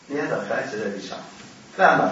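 normal talking voice

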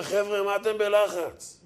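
lightly laughing imitation; Kolorabi's voice. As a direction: mimic the Kolorabi unbothered. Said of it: "עם הקול של הקולורבי".

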